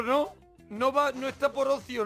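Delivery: ordinary delivery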